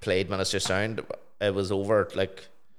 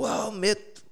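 much higher, chirpy London lad's greeting; London stranger; 13 semitones higher, slower than usual. Retold or imitated. Stranger crossing the road hails him, high chirpy cockney call. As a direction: hail him like a cheeky cockney geezer. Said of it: "this guy ran across the road and started going".

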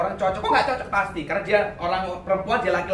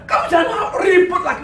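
loud, high comic retort; acted quarrel retort; 9 semitones higher, 6 dB louder than usual. Spouse answering back during a baby quarrel, mock shouting.

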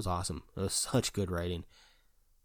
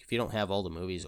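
usual speaking voice

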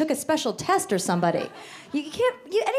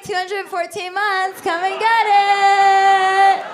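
slow, high boast building to a long loud holler; grown-up hollering come-on; 6 semitones higher, 6 dB louder, much slower than usual. Acted. Grown-up at a bar giving age, brash holler. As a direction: stretch the last words out, belted and hammed up. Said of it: "a grown-up going to a bar and be like".

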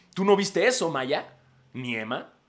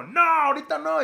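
mock mom refusal; 13 semitones higher, 6 dB louder than usual. Cousin refusing the kid.

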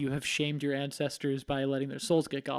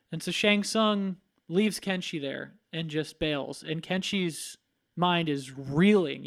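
ordinary talk, nothing marked

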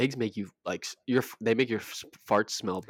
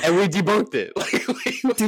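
laughing; 8 semitones higher, 4 dB louder than usual. Real, not acted. Laughing while telling.

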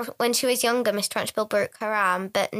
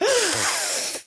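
high sharp gasp; shocked gasp; 9 semitones higher than usual. Retold or imitated. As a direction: gasp in outrage. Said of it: "Miss Trunchbull's like".

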